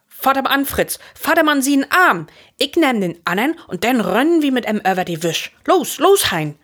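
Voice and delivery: high, urgent rallying call; rally the boys to get moving; urgent orders. A narrator's reading. Urgent orders to run for help. (hild andrieven, hooch)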